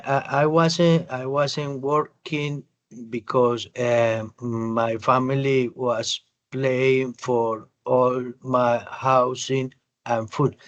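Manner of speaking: slow, halting, word by word with an eh; imitating hesitant reading. Teacher imitating a student's hesitant reading. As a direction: stumble through it like a nervous reader. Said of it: "comenzó a hacer el gagueo aquel".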